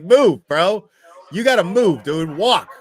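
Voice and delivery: firm order to move along; stern cop voice. Retold or imitated. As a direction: act the cop barking at her. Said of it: "The cop's like".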